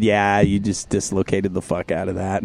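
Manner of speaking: low, soft deadpan delivery; understated low doctor voice; voicing hospital staff. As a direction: drop low and quiet, matter-of-fact. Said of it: "And they said".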